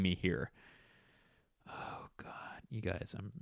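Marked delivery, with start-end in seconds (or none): 1.7-3.3 exhausted, breathy-free whisper; tired whisper. Real, not acted. Tired host confessing to listeners, real whisper. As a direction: let it slip out in a worn-out whisper. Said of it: "I'm pretty tired".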